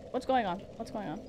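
ordinary delivery

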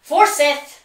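urgent alarm call; warning shout. A narrator's reading. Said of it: "Bevel shouts".

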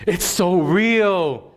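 very slow, drawn-out mock teen voice; imitating his daughter. Father mimicking his daughter's verdict, slowly drawn out. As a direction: stretch it out like a teen stating the obvious. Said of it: "He goes".

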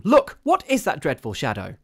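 loud, high, alarmed question; horrified demand. A narrator's reading. Missus Brigham to Caroline about the shadow, horrified demand.

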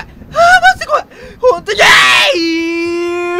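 high wailing scared cry; hit hard crying out. Real, not acted. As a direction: wail in fright. Scared, forced cheer.

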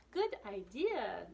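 pleased agreement. Acted. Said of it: "they say".

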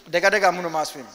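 imitating a worker's loud stubborn boast; loud insistence. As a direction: belt it out like a stubborn dreamer. Worker insists he will make it.

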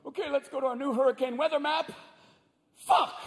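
high, anxious announcer erupting in a yelped curse; steady presenter voice cracking into a panicked squeal; freaked-out weatherman. Acted. Weather presenter unveiling a frightening new map, freaked out. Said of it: "the guy in the Weather Channel is freaked out".

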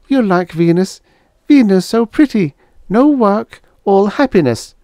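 high, loud, plainly reproachful alien; Venusian girl. Acted. Venusian girl telling Helen she always walked away.

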